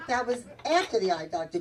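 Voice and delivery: ordinary delivery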